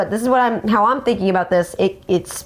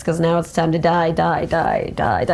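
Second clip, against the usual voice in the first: menacing villain; 5 semitones lower than usual. Retold or imitated. Chants die, dropping low.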